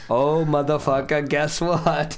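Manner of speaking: high, loud triumphant taunt; gleeful taunt. Retold or imitated. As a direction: gloat at the attacker. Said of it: "And you're like".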